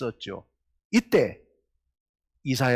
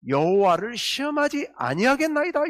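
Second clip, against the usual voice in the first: 여호와를 시험하지 아니하겠나이다 with 아니하겠나이다 pitched higher and louder than the read take; lightly voiced pious king, said higher; voicing King Ahaz; 8 semitones higher, 4 dB louder than usual. Pastor voicing King Ahaz's refusal, higher, mildly pious. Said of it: "이렇게 얘기를 하고 있는 겁니다".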